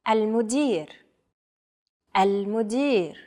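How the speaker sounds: "المدير" said as a careful model; model pronunciation. Shown as a demonstration. Native speaker asks learners to repeat after her, model pronunciation. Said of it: "Repeat after me".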